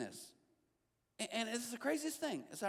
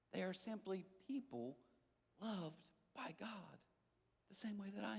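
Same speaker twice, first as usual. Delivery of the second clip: tender resolve; 10 dB quieter than usual. Acted. Resolved affirmation.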